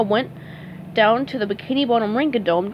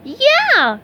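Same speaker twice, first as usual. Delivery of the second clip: happy agreement; 13 semitones higher, 6 dB louder than usual. A narrator's reading. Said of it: "SpongeBob agreed".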